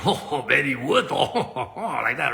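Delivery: hammed-up French accent, slow and laughing. Restaurant woman recognizing Benny Wood, mock French accent. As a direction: imitate a gushing French accent with drawn-out ohs. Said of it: "she's got this French accent".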